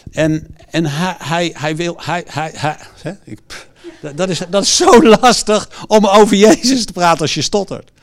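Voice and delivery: halting stutter, repeated starts; mock stutter then a sigh; imitating a stutter. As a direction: stammer over the first words. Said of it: "Want hij stottert".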